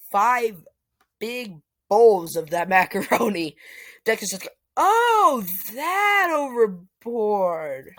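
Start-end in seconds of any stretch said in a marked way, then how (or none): 4.8-7.9 very high loud gasp, then slow; stunned surprise. Acted. Deku seeing five bowls of macaroni, stunned. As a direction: gape at the huge pile of food.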